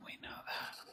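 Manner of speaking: hushed conspiratorial aside; a quick whispered aside. Real, not acted. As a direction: whisper it like a secret. Conspiratorial aside about accents.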